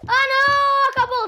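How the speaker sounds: loud high dismayed cry; frustrated shout. Real, not acted. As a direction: shout in real disappointment. Time ran out in the game.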